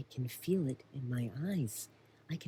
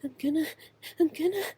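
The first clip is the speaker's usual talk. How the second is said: about to sneeze; 11 semitones higher, 5 dB louder, much faster than usual. Acted. Sneeze building.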